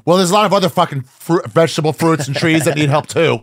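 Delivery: mock the outraged Twitter reply; preachy scold.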